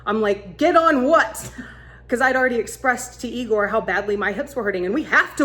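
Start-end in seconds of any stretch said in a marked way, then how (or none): 0.6-1.5 loud, higher baffled question; incredulous. Retold or imitated. Woman with bad hips answers her guide, loud incredulous retort. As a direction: throw it back in disbelief.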